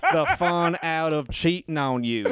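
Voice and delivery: put-on Southern-accented punchline; Chuckleberry Finn. Comic continuing his bit amid laughter, Southern accent. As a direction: tell it as a Southern comic character. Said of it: "try saying them in a Southern accent".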